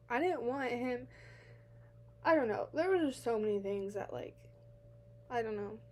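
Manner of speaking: ordinary delivery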